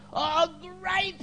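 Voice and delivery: very high, drawn-out exclamation; broadcasting the news. Retold or imitated. Church musician hears of conversion, broadcasting the news. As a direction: cry it out for the whole hall. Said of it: "he just broadcast it to everyone".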